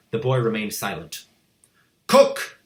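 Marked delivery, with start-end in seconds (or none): 2.1-2.6 loud sharp call; shouted. A narrator's reading. Headmistress calling the cook in, shouting. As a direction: yell toward the door. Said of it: "the Trunchbull shouted".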